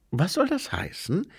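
high, wary question; high-voiced, mistrustful angel; suspicious angel. A narrator's reading. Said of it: "fragte der Engel misstrauisch".

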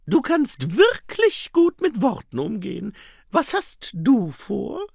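high, sharp and wary; narrowed eyes, slowly probing; suspicious. A narrator's reading. Saccharissa suspicious. (misstrauisch und spitz)

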